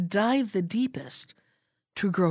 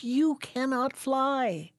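"you cannot fly" very slow, higher, falling with regret; sad conclusion; 5 semitones higher, much slower than usual. A narrator's reading. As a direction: let "you" sink sadly and slowly.

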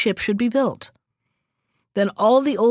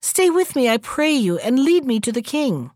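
usual speaking voice

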